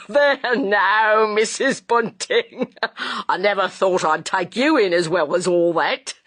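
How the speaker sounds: chuckling through the words; jovial laughing. A narrator's reading. Joe teasing Mrs Bunting after fooling her, jovial. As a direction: laugh it off, pleased with the trick.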